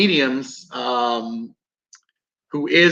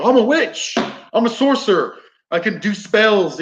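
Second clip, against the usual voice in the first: mock teen boast, pitched up; teenage wannabe witch; 7 semitones higher than usual. Teenage girl or boy claiming to be a witch, mocking. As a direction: put on a higher, self-important teenager's voice. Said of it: "for every teenage girl and boy".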